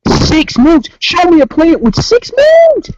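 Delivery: high disbelieving outburst; can't believe what he heard; incredulous. Real, not acted. Incredulous at six moons.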